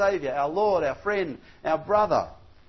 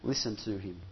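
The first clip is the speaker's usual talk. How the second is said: softer and lower, a grave hush; hushed voice of God; 9 semitones lower, 8 dB quieter than usual. Retold or imitated. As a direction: say it softly like a solemn divine command. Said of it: "And God says".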